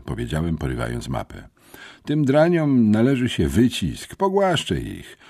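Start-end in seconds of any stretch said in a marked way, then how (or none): 2.1-5.2 high-pitched, pugnacious insistence; stubborn insistence. A narrator's reading. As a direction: dig your heels in, itching for a fight. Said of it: "upierał się Bernard".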